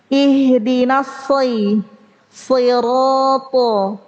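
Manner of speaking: "Sirata" slow, with a heavy doubled s; correct sod. Shown as a demonstration. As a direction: hold the thick s of "Ihdinas si". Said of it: "bukan shi". ("Sirata" pelan dengan sod tebal)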